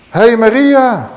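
slow, high, loud call of Maria; drawn-out loud call. Acted. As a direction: shake her awake by calling her. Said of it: "omdat ze geroepen wordt".

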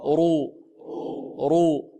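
heavy R in رو, held for repeating; thickened R with a long u in رو; تفخيم الراء. Shown as a demonstration. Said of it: "الراء المفتوحة والمضمومة مفخمة". (رو براء مفخمة)

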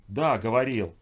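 loud, forceful yes. A narrator's reading. Pressured Sergey answers Ho's demand to speak up, loudly. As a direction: raise your voice to be heard. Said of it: "Громче, я не слышу".

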